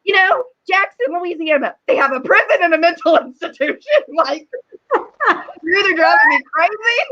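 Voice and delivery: explaining to husband, laughing Southern sass; sassy explanation. Retold or imitated. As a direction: spell it out to the husband with mock menace. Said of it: "And I'm like".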